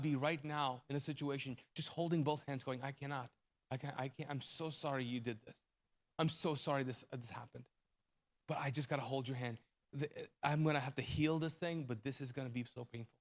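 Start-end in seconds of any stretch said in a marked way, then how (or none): 2.8-13.1 much softer, lower, grieving; hushed sorrowful God. Acted. God speaking to the hurting listener, soft grieving hush. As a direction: say it gently like holding a hurt hand.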